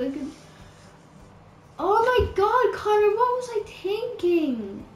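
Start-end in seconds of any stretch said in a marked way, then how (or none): 1.8-4.8 higher and louder, self-scolding; exasperated outburst. Real, not acted. Gamer scolds herself over deleted saves, loud and exasperated. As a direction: cry out at your own mistake.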